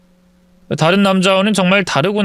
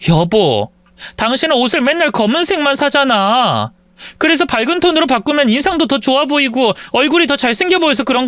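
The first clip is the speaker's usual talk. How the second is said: higher voiced wife, pleading her case; wife's hurt voice; 7 semitones higher than usual. Wife justifying herself to her husband, hurt and pleading. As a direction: explain yourself, hurt and insistent.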